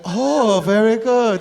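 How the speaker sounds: high, mock Chinese-accented praise; impressed Chinese accent. Chinese person praising someone trying the language, impressed, accented. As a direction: coo approval in a put-on Chinese accent. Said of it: "they're so impressed".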